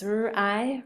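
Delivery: "r-i" as a separate er, then long I; tapping out sounds. Shown as a demonstration. Teacher showing how it goes, sound by sound. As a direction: sound out "r-i" in two pieces. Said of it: "And it goes".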